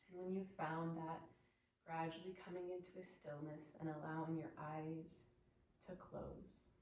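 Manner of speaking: soft meditation voice with long pauses; guided meditation. Real, not acted. Yoga teacher guiding students to observe their breath, soft meditative voice. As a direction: gently settle them into stillness, leaving space between phrases.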